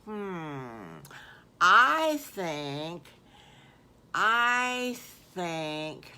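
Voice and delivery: put-on thinking out loud in a kid voice; lightly voiced piglet thinking; pondering piglet. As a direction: hum and ponder like a little pig. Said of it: "And Squiggly says".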